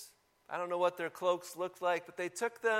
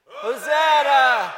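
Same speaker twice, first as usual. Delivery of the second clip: long loud higher Hosanna; shouted Hosanna; 6 semitones higher, 11 dB louder, much slower than usual. Shown as a demonstration. Pastor leading the congregation in a shout, loud. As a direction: lead the shout with full voice. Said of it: "today we have to shout Hosanna".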